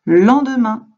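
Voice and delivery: "Le lendemain" called out loud and high; answer reveal. Shown as a demonstration. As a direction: announce the answer proudly. (réponse annoncée fort)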